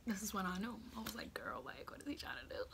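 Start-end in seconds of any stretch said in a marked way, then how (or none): 1.3-2.6 whispered, barely voiced private thought; hushed bewilderment. Girl wondering as he pulls her in, hushed bewilderment. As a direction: whisper it, puzzled.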